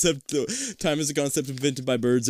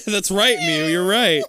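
usual speaking voice

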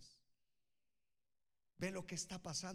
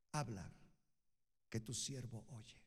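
low-pitched, measured answer to God; humble reply; 6 semitones lower than usual. Acted. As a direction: drop the voice low and answer as an obedient servant. Young Samuel answers God reverently.

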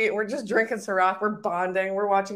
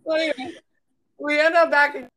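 laughing; 7 semitones higher, 7 dB louder than usual. Real, not acted. Laughing while telling.

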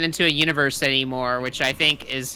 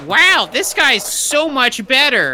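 impressed studio; 9 semitones higher, 3 dB louder, slower than usual. Retold or imitated. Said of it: "maybe they went".

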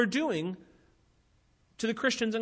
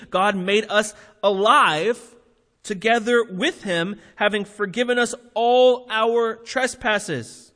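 forceful preaching; 5 dB louder than usual. Acted. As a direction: proclaim it to the whole room.

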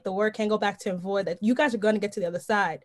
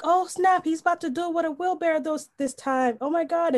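higher-pitched buzzing amazement; excited crowd reaction; 7 semitones higher than usual. Retold or imitated. Crowd reacting to the tightrope walker with a wheelbarrow, excited. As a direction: imitate an onlooker getting hyped. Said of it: "everyone's like".

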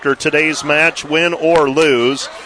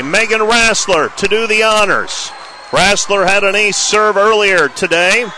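tense match point call, pitched up; play-by-play; 6 semitones higher than usual. Real, not acted. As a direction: build the tension of match point.

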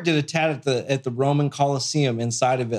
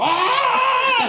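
high, loud falling scream; mock panicked scream; 3 dB louder than usual. Tattooer free-falling from plane, panicked scream.

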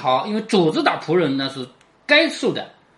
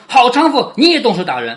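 mild scolding, louder on the opening insult; narrated angry curse; 5 semitones higher, 6 dB louder than usual. A narrator's reading. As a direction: read it as an understated angry rebuke. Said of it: "上来踢骂说".

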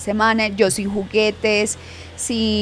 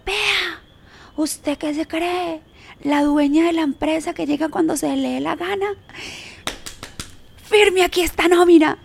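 high, harsh scolding boss voice; mock angry yelling; 7 semitones higher than usual. The boss yelling at her for arriving late, angry mocking. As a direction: bark it like a furious supervisor. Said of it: "me ha pegado un grito así horrible".

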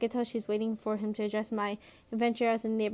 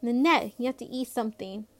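usual speaking voice

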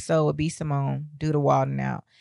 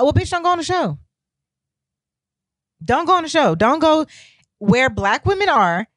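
angry retort; 7 semitones higher, 3 dB louder than usual. Real, not acted. Angry rant at commenters.

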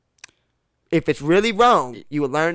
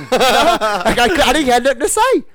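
laughing, higher and louder; 6 semitones higher, 5 dB louder, slower than usual. Real, not acted. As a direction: laugh through it, helpless at the memory. Laughing at his own story.

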